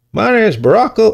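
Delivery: high, hammed-up presidential introduction; Obama impersonation. Podcaster introducing himself as the president, high mock Obama voice. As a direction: pitch it way up as a bad politician impression. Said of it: "all mixed in in that impersonation".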